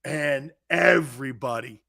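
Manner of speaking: ordinary talk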